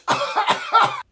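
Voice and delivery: high hacking burst; coughing fit. Acted. Sick boy after others celebrate, coughing.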